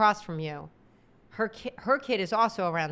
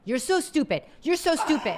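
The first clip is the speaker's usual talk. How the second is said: higher, climbing taunt; imitating a rude mom calling someone stupid; mocking insult; 7 semitones higher, 3 dB louder than usual. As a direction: sneer it, pitch rising each time.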